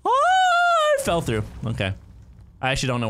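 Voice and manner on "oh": loud, very high long "oh"; falling scream. Real, not acted. Player falling through the level, screaming.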